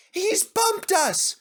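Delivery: high, slow shrieking complaint; screaming coaches. Acted. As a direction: shriek it out, outraged. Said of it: "screamed the coaches".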